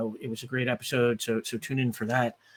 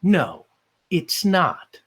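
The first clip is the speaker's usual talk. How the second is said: smiling smug refusal; 4 semitones higher, 4 dB louder, much slower than usual. Acted. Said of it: "Buck smiles and he goes".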